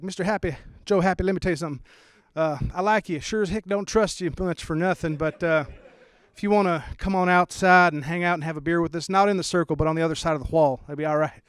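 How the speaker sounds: friendly but wary cowboy; cowboy accent. Retold or imitated. Bush-fan neighbor inviting the dad over, cowboy twang. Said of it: "He'd be like".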